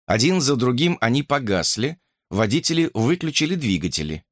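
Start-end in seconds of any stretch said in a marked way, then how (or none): none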